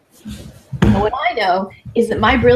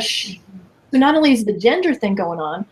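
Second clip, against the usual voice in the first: ordinary delivery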